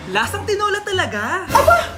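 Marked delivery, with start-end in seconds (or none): 1.5-1.9 offended. Acted. Offended outburst at the table.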